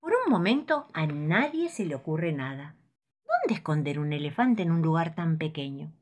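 ordinary delivery, nothing marked

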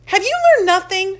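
high, loud playful scolding; teasing sass. Acted. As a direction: tease your friend with mock outrage. Keisha teasing her friend.

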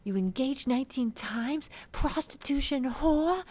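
high, slow, hammed-up screech of outrage; loud mock flip-out, each phrase drawn out; Teresa. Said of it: "Teresa fucking flips at this point".

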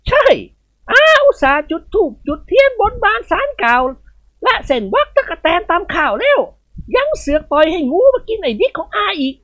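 put-on high, commanding old lady's voice; a matron rallying every servant to hunt the snake; bossy old lady. Khun Ying Wad complains.

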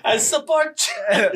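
high mock foreign accent; Borat. Trading movie lines with friends, a laughing Borat voice. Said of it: "I can only do fucking Borat's voice".

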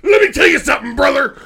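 high, shouted wrestling rant; hammed-up wrestler promo. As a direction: bellow mock threats, building to a full yell. Mock wrestler promo at rival podcaster.

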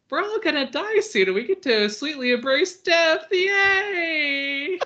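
falsely cheerful little creature, very high-pitched; squeaky-high mock glee over doom, ending in a loud cheer; Luma. Little star creature in prison welcoming death, falsely happy. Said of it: "pretends it's happy".

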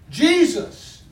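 a high, loud cry of a name; calling out. Retold or imitated. Blind Bartimaeus calling to Jesus on the road, crying out loud. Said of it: "to call out".

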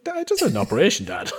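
high, fast, laughing whine; whiny protest to dad. Retold or imitated. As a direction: plead with dad, half laughing. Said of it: "I was like".

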